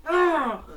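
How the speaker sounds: loud, high cry as something falls; mock scream. Zombie girl sees the tree falling, mock scream. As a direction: let out a cartoon scream. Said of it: "she screams".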